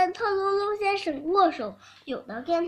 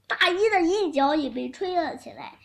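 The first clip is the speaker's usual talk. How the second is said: usual speaking voice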